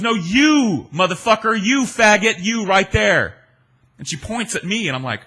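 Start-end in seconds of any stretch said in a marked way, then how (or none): 0.0-3.4 loud high mock yell; retold shout. Anna Nicole singling him out on the street, loud mock yell. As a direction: yell it out, jabbing a finger. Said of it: "And she goes".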